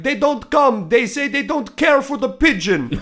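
high, loud impression of an old Dutch man; hammed-up Dutchman shouting from a balcony. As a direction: call down as a Dutch neighbour. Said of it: "somebody shouted from".

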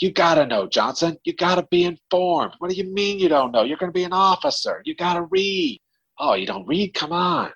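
imitating an exasperated coach; hammed-up coach telling them to read; coach scolding. Coach scolding an uninformed player.

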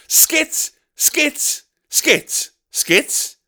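high squawked "Skits!"; derisive, high-pitched parroting; mocking repetition. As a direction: spit the word out in derision, starting high and dropping. Mockingly repeats the other person's word.

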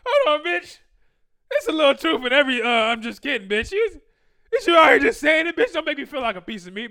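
loud, high-pitched mock outrage; acted indignant protest. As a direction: shout back as if insulted, hamming it up. Acted protest at her insult.

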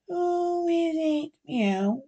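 high, soft, slow mewing voice; slow high meowing question; cat voice. Acted. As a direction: say it like a cat talking. Cat-like voice at door.

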